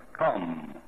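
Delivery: deep, slow beer-ad announcer; radio announcer's voice. Retold or imitated. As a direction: try out a deep old whiskey voice. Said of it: "it's a real slow voice".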